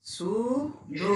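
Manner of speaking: "শুধু" very slowly, syllable by syllable; syllables drawn out. Shown as a demonstration. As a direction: stretch both vowels of "শুধু". Teacher demonstrates splitting the syllables. ("শুধু" টেনে টেনে, খুব ধীরে)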